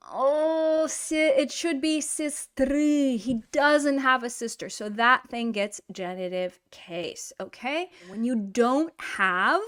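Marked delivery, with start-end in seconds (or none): 0.9-3.2 сестры said slowly as the fix; corrected form. Shown as a demonstration. Teacher giving the correct genitive сестры, slow. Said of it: "Потому что у меня нет".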